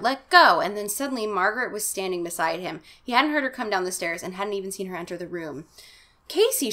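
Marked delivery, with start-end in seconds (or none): 0.0-0.6 loud, higher desperate shout. Acted. Casey breaking free of his voicelessness, loud shout. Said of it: "finally is able to shout". 6.3-6.7 very high alarmed cry; cried. Acted. Margaret finding Casey in the tree's grip, high cry. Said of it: "she cried".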